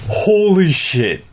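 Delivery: awed. Real, not acted. Monster lunges at him.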